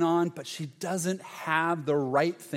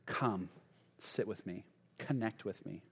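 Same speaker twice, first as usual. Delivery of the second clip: much softer and lower, gentle; tender invitation; 7 semitones lower, 10 dB quieter than usual. Acted. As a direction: call her in close and hushed. Jesus invites Martha to sit.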